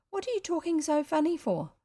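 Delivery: put-on high, girlish question; puzzled little girl. Girl asking boy about his voice, puzzled. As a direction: ask like a baffled child.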